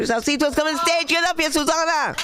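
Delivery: high, excited announcer call; hype intro. Real, not acted. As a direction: hype the crowd up for the next act. Host hyping next comic onstage.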